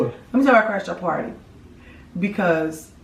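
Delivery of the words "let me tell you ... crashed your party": rushed fast repeat. Real, not acted. Repeating to her partner, fast and playful. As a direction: Let me tell you why I crashed your party rattled off fast. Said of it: "So here it is".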